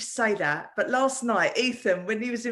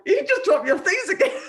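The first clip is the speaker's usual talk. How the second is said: laughing; 10 semitones higher, 7 dB louder than usual. Real, not acted. Laughs while scolding in passing.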